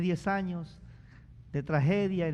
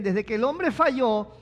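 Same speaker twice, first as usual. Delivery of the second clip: ordinary talk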